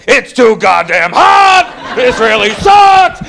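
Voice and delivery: drawn-out, shouted griping; hammed-up seasonal outrage; mad right away. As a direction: complain loudly, leaning long on the last word. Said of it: "I get mad right away".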